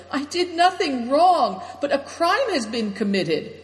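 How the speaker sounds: high wail, easing as it goes. A narrator's reading. Affliction tells of a crime on his knees, wailing. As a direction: plead innocence in a high, stretched wail. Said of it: "he wailed".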